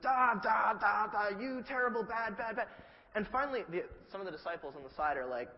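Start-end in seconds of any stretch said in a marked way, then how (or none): 0.0-2.7 mock tirade. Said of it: "just laying into these people".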